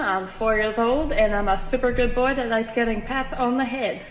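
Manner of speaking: 낮고 조용한 강아지 Kevin 목소리; 착한 강아지인 척 살짝 낮게 중얼. Acted. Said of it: "This is just Kevin's voice".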